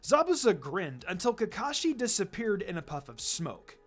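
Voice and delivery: ordinary delivery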